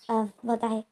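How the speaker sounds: ordinary delivery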